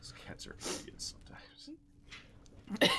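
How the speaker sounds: whispered grumble about the cats. Real, not acted.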